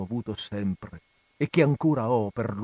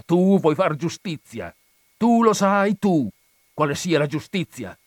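louder and higher, rising to an outraged cry; stern rebuke; 5 semitones higher, 6 dB louder than usual. Acted. Friar scolds vengeful Renzo: rising outraged rebuke. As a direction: thunder at him, rising with outrage.

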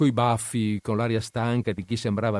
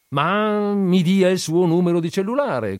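brisk brush-off; 9 semitones higher, 7 dB louder than usual. A narrator's reading. Industrialist brushing him off.

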